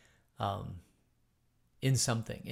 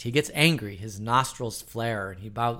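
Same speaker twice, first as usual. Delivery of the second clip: ordinary talk, nothing marked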